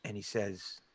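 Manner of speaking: ordinary talk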